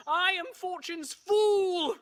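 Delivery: loud, high, drawn-out theatrical cry. Acted. Romeo cries to heaven over his deeds, hammed-up shout. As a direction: wail it to the heavens. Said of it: "crying out".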